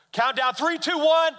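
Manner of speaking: loud, high countdown; counting down. Retold or imitated. Dad calling a countdown to his daughter on the slide, loud.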